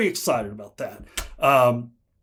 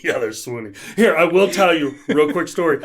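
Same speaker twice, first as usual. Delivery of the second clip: ordinary delivery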